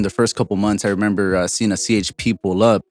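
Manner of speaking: usual speaking voice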